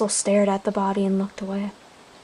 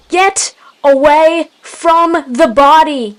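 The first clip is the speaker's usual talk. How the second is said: slow, drawn-out loud order; yelled; 6 semitones higher, 10 dB louder, slower than usual. A narrator's reading. Leader repeating his warning to the clan, slow forceful yell. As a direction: yell it word by word, higher. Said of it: "he yelled once more".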